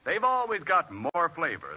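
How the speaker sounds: usual speaking voice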